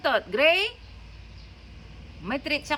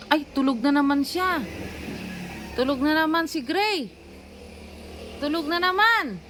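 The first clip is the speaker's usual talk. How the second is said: exasperated; 6 semitones higher, 4 dB louder than usual. Real, not acted. Exasperated at sleeping pet.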